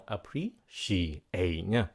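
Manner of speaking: Appreciate drawn out very slowly, syllable by syllable; slow demo. Shown as a demonstration. Showing students the slow take of Appreciate. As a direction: say Appreciate slowly before speeding up. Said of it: "Nói nhanh này nha".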